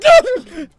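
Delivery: very high, loud shriek; mock scream no. Swimmer pulled under by the man, high mock shriek. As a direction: shriek no as you are dragged under.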